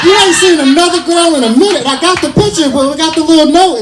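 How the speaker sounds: high-pitched, exasperated plea; worked-up complaint. Acted. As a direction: push the voice up high as if pleading your case. Playing the desperate deployed soldier's plight.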